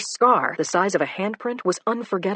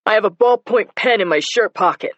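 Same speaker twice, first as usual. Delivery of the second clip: loud answer; 5 semitones higher, 7 dB louder than usual. Acted. Suspect answering cop.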